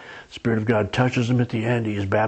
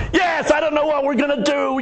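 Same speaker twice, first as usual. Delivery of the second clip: panicked shout; 14 semitones higher than usual. Retold or imitated. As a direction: shout it like you've lost your head. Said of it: "They want you to go".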